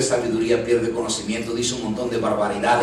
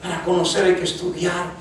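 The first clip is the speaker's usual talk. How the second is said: preaching at full force; 5 semitones higher than usual. Acted. Preacher urging the congregation to know the truth, preaching hard. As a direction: hammer each line home to the church.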